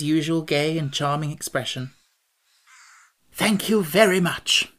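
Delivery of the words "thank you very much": slow, higher, cheery thanks; bright. A narrator's reading. Restuffed monarch thanking his helper, brightly. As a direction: say it brightly, drawing it out. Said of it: "said the monarch brightly".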